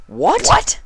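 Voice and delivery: loud, high shocked; surprised. Acted. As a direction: double take. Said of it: "asked in unison".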